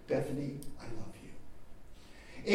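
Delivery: softly, a mother soothing her raging daughter; hushed, gentle, almost whispered the second time; tender repeated love. Retold or imitated. Mother calming her furious daughter, soft and tender. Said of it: "Over and over and over again".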